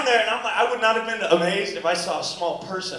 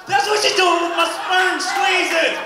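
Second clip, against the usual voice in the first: mock disgusted shout; 10 semitones higher, 4 dB louder, slower than usual.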